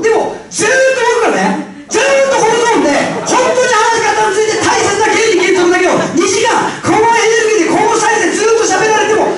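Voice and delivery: loud, high, relentless lecture voice held at full energy; loud high monotone. Shown as a demonstration. Seminar speaker lecturing the hall too loudly, relentless shouting. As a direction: blast it out without ever dropping.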